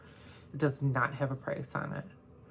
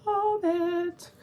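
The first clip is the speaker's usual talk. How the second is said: gushing; 5 dB louder than usual. Real, not acted. Excited aside about the hashtag.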